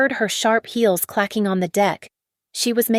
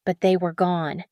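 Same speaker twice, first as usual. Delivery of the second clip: usual speaking voice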